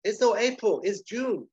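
high, loud, earnest correction; confused waiter. Acted. Manuel misunderstanding the dealer, high confused correction. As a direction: correct him in a foreign waiter's voice.

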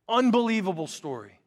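normal talking voice

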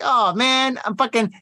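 high-pitched, lightly voiced relieved fan; a hint of a delighted listener; imagined listener. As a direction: lift the pitch as an imitated happy listener. Voicing a listener who feels better.

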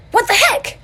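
high startled yelp; Monkey yelps in disbelief at vanishing Tiger; shocked squeak. Acted. High startled what the heck.

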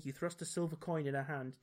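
usual speaking voice

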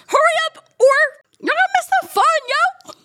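squeaky high, hurried urging; high playful hurry-up call; excited high call. Acted.

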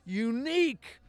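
lightly mocking a cocky young comic, drawn out slowly; slow, smug take on a kid who thinks he's original. Telling the podcast hosts about a young comic, mildly mocking smug drawl. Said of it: "you're so young and you're so confident".

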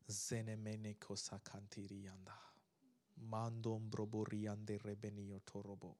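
low, soft praying in tongues; hushed devotional tongues; quiet tongues prayer. Acted.

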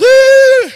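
high, short mock wail; high mourning wail; mourners' wail. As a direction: cry out like a mourner. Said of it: "And they wailed".

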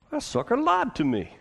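put-on indignant Southern accent, high. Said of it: "You gotta say it in a southern accent".

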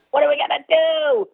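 high, hammed-up helpless wail; character actor impression. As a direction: wail it in a mock movie-character voice. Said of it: "That's what he sounds like".

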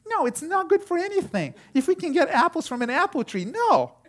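put-on high-pitched, adamant little girl; loud, high, emphatic mimicry of a daughter; emphatic child. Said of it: "She was so emphatic".

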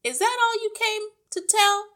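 very high, loud irritated challenge; ratty. A narrator's reading. Wife challenges teacher over missed program, ratty. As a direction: demand it impatiently in a high voice. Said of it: "ratty about missing her program".

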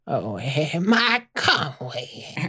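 high, slow, feeble moan; murmuring. Acted. Sick Mama Leslie on the doctor's table, murmuring. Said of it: "She's murmuring".

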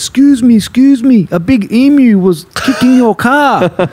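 high, loud alarmed backpacker imitation; urgent backpacker. Backpacker alerting the car's owner, urgent mock call. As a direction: call out urgently, pitch jumping up. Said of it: "he comes up to him and goes".

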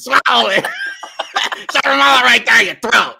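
shouting through laughter, pushy; loud mock force-feeding. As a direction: cram it down, hammed-up. Mocking Iago force-feeding.

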